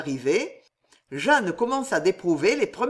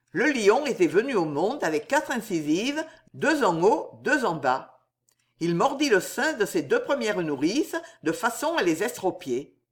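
usual speaking voice

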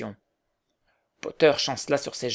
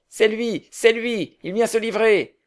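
high, loud cries from the crowd; crowd shouting; 8 semitones higher, 5 dB louder than usual. A narrator's reading. Crowd shouting that the murderer comes to give himself up. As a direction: call it out as an excited crowd. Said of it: "des voix crièrent".

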